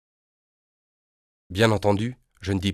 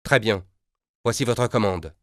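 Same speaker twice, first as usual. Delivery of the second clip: angry tone; 3 semitones higher, 3 dB louder than usual. Acted. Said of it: "prend un ton rageur".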